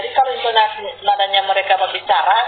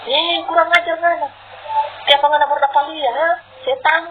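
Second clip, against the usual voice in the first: higher-pitched, hammed-up telling-off; mock-scold like a coarse Sulawesi brawler; imitated rough cursing; 7 semitones higher than usual. Preacher imitating everyday Sulawesi talk for laughs, mock rough cursing. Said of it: "Sulawesi ngomong saja kasar".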